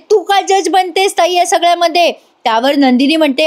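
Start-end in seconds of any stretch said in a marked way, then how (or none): 0.1-2.1 mock yell. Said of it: "ओरडत म्हणते".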